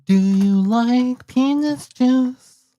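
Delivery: very high, very slow, drawn-out joke question; silly character voice. Shown as a demonstration. Goofing on his recording line, very high and slow.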